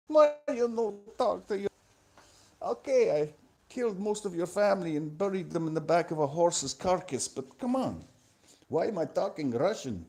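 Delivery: put-on hammy, slipping Eastern European accent. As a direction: do a bad mock-Russian accent. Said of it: "slipped into a horrible generic Eastern European accent".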